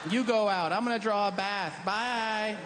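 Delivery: high prissy voice, lounging; lazy dick. Acted. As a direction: play the dick as a pampered homebody. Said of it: "today you're like".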